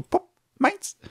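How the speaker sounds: high, quick mock snatch; popped out high, a curt little imitation; mimicked grabbing retailer. Online shop snatching back a bought e-book, mock high grab. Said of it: "und dann einfach so".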